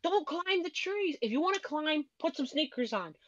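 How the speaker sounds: high-pitched scolding, no real volume; mock screaming. Parents to son climbing in sandals, retold yelling. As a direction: imitate parents yelling up at a child. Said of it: "were both screaming at him".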